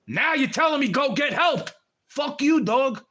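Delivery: raging shout at someone who said "go get help"; furious shout. Real, not acted. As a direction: explode at them in real anger. Furious at someone dismissing him.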